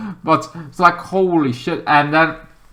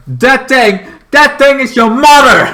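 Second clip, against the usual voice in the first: much higher and louder, accusing shout; yelled mom joke; 10 semitones higher, 9 dB louder than usual. Retold or imitated. Polka-Dot Man told the monster is his mother, loud accusing shout. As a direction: yell it as an accusation.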